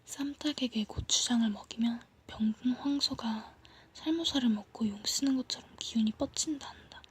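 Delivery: usual speaking voice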